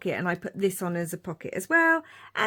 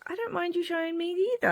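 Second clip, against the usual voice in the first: higher, fast, lightly voiced sweet reply; quick, higher mock of the partner being sweet; imitating son's partner; 9 semitones higher, 4 dB quieter, faster than usual. Son's partner saying she doesn't mind photos, higher and fast. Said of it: "she was like".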